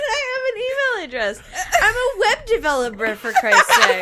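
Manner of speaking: high, indignant, half laughing; exasperated laughing protest. Real, not acted. Web developer insulted by aunt's question, exasperated protest.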